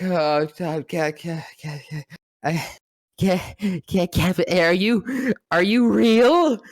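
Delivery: nervous stutter building to a cry; starstruck stammer. Retold or imitated. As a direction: trip over the name, then blurt it. Stammering at meeting his hero.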